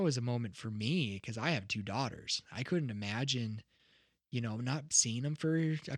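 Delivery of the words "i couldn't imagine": normal talking voice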